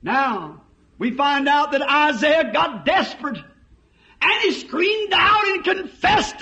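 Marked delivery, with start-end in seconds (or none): 4.2-6.4 screamed. A narrator's reading. Preacher retells Isaiah's cry.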